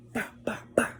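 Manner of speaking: breathy bah bah punches; whispered mock punches; whispered hit noises. Imitating hitting someone.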